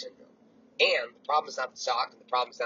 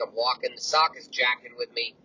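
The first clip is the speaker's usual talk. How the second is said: ordinary talk, nothing marked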